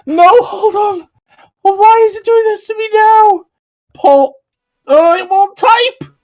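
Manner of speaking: high, loud frantic pleading; panicked shouting. Real, not acted. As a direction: yell at a frozen computer in panic. Computer freezes mid-stream.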